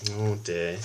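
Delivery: ordinary delivery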